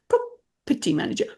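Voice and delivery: "Property manager" with the weight on PROP; stress on first syllable. Shown as a demonstration. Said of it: "did a bit of coaching going".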